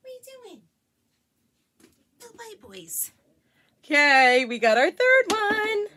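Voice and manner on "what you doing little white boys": pet-talk voice. Real, not acted. Mom greeting her dogs in high baby talk.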